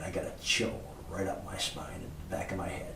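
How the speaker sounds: ordinary delivery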